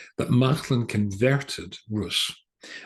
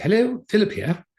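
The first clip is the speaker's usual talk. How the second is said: high, louder cheery phone hello; jolly, friendly greeting; 13 semitones higher, 6 dB louder than usual. Retold or imitated. Oxford librarian greeting a caller, jolly and friendly. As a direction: answer the phone high and full of jolly welcome. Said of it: "very jolly and very friendly person".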